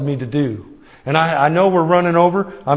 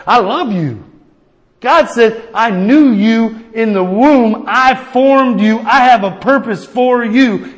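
voice of God; 5 semitones higher, 4 dB louder, slower than usual. Retold or imitated. Voicing God tenderly.

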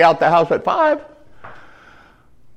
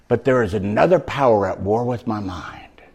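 soft whispered confession; 5 semitones lower, 5 dB quieter than usual. Acted. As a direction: whisper the inner war. Whispered line.

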